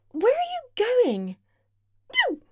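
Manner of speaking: startled, needy high question; surprised high whine. Acted. Partner pulls away.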